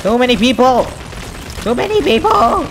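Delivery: high cry rising into a squeal; overwhelmed panic. Real, not acted. Player swamped by enemies, rising high-pitched panic.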